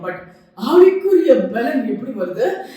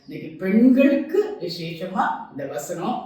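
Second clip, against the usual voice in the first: normal talking voice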